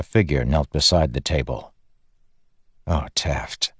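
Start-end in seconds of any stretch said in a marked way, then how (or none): 2.9-3.7 quiet, disappointed sigh of a name; gently dismayed, almost under breath; soft pity. A narrator's reading. Kaladin kneels by Teft.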